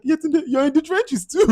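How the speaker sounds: high teasing comeback; mock retort. Real, not acted. Reviewer teasing Yetunde back, high and mocking.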